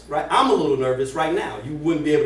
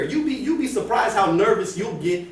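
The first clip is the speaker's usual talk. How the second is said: normal talking voice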